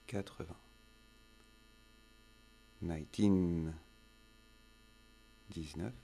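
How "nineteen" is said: put-on "Nineteen" stressed on teen, nine-TEEN said loud; word stress. Shown as a demonstration. Teacher drilling number stress, nine-TEEN stressed clearly. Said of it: "l'accent tonique en anglais".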